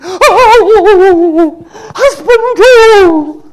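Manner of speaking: high loud shriek of laughter; laughing cry. Retold or imitated. Laughing ladies shrieking at the husband question, high and loud. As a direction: squeal it like embarrassed ladies laughing.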